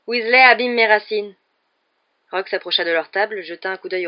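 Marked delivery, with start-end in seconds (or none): 0.1-1.3 loud, higher drawling complaint; drawling schoolboy. A narrator's reading. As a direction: tell on a classmate to the teacher. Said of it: "de sa voix traînante".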